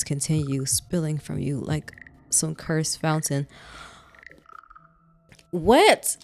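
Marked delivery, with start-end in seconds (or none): 5.5-6.2 sudden high startled yelp; shocked what. Real, not acted. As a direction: blurt it out in disbelief. Player jolted by gruesome story text.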